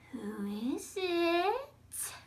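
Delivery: high, drawn-out call with a rising end; an old witch calling out through her door; witch's voice from inside. Acted. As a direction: stretch each word slowly, sliding upward. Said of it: "said a voice from inside of the cottage".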